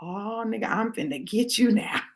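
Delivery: very soft, low gloating inner voice; hushed scheming. Acted. Woman plotting revenge on her ex in her head, hushed gloating. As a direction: breathe it like a secret plan.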